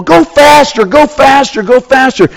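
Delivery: urging. Retold or imitated. Friends urging the driver to speed up, high excited chant.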